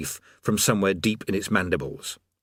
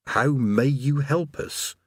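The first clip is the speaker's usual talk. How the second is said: slow, aghast disbelief; appalled and incredulous, drawn out; 5 semitones higher, slower than usual. A narrator's reading. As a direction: draw the question out, aghast. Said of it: "he said, aghast".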